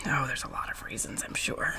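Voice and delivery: very soft whispered reply; whispering. Acted. Player answers partner about his reasons, whispering. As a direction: keep it to a breathy whisper.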